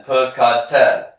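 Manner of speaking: loud, carefully sounded-out word; repeat-after-me model. Shown as a demonstration. Teacher leading a class drill, loud model word. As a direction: give it to the class syllable by syllable. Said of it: "Repeat after me".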